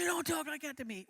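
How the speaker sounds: retold angry shout, pitched high and loud. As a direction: raise your voice in an irritated comeback. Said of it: "His voice got louder".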